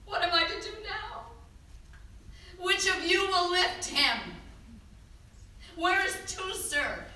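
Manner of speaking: loud, high, desperate cries over the body; a grieving woman pleading loudly for help; anguished cries. Acted. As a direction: call out frantically for help with the body.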